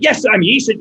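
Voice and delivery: pleading mom. Retold or imitated. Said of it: "My mom was like".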